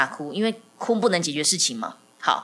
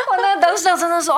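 talking while still laughing, higher; laughing through words; 11 semitones higher, 5 dB louder than usual. Real, not acted. Mother retelling her reply, laughing, higher. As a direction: giggle the words out.

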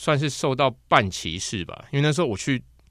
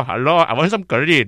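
a light mock Indian accent, pitched higher; 5 semitones higher, 7 dB louder than usual. Kid teasing friends, lightly mocking Indian accent. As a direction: playfully put on an Indian accent. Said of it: "如果去印度腔说".

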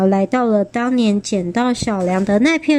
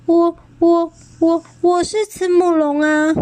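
stammering fright; 8 semitones higher than usual. A narrator's reading. Child stammering in fear.

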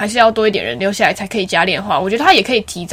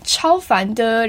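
annoyed drawl; 3 dB quieter, much slower than usual. Real, not acted. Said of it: "annoyed drawl".

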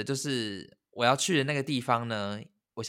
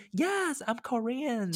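high, bright put-on voice for the Korean guy; Korean man's reply; 12 semitones higher, much slower than usual. Korean coworker answering her question, high put-on voice.

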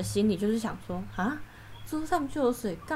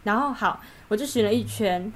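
usual speaking voice

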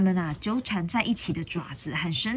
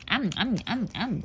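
playful chomp-chomp sounds; rhythmic closed-mouth gobbling; munching noises; 3 semitones higher than usual. Acted. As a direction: mimic gobbling them up, bite by bite. Imitates gobbling up dinosaurs.

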